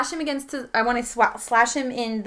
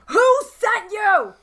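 high, drawn-out yell at an attacker; raised, high-pitched interrogating shout; 11 semitones higher, 4 dB louder, slower than usual. Acted. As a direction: yell the demand, stretching the words. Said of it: "And I'm yelling".

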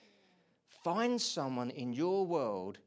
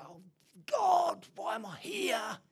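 much higher, shouted whine to God; mock anguished cry; 7 dB louder, slower than usual. Believer to God, blaming Him, mock wailing shout. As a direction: wail it like a wronged complainer.

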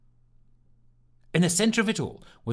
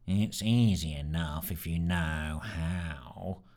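much lower, softer, slow mutter; dull muttering; 7 semitones lower, 5 dB quieter, much slower than usual. Acted. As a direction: mutter it to nobody. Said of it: "came the dull muttering again".

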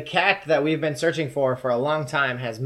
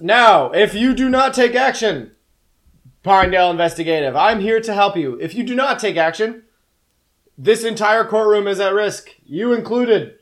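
booming voice; 7 semitones higher, 8 dB louder than usual. Acted. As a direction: boom it out like an otherworldly cat. Demon cat warns the court.

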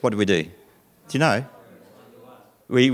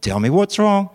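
a touch of a gentle mum, pitched higher; calm coaxing; 8 semitones higher, 4 dB louder, slower than usual. Retold or imitated. Mother calmly asks her children what's wrong, lightly voiced. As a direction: coax the kids down patiently. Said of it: "she doesn't say".

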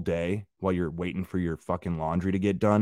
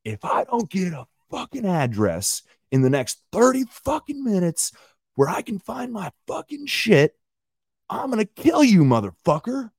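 imitated screaming; 12 semitones higher than usual. Quoting a screaming laundromat man's threat, imitated scream. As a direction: imitate an enraged man yelling threats, pitch way up. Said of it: "I hear him scream".

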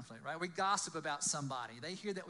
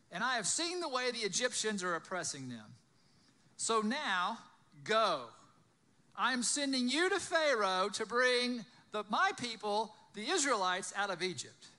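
loud, higher-pitched storytelling voice; dramatic scripture reading; 5 semitones higher, 8 dB louder than usual. A narrator's reading. Preacher reading the burning bush story aloud, loud and dramatic. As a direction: read the Bible story out to a crowd.

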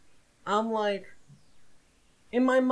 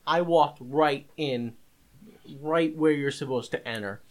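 normal talking voice

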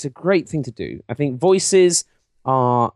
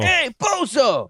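high, drawn-out New York heckle; New York accent; 12 semitones higher, slower than usual. Acted. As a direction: call out like a hammed-up New Yorker. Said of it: "when you do New York".

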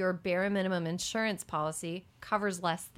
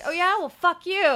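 higher, louder retort as the spurned senator; mock defiance; 9 semitones higher, 7 dB louder than usual. Imagining the senator getting back at the party that abandoned him, mock defiant. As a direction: fire back in imitated defiance.

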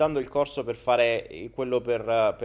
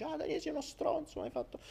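mocking accuser; 13 semitones higher, 13 dB quieter, faster than usual. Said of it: "Che dici".

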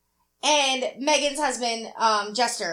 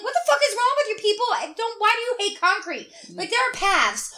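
high, fast mock outrage; a hammed-up furious complaint; exasperated rant; 4 semitones higher than usual. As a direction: rant at the group for the grass. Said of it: "I'm like".

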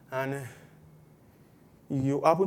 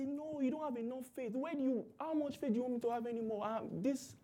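much softer, higher, near breaking; quiet overwhelmed reply; 6 semitones higher, 12 dB quieter than usual. Retold or imitated. Believer quietly answering Christians who say he lacks faith, overwhelmed. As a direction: plead it under your breath. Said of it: "But Christians say".

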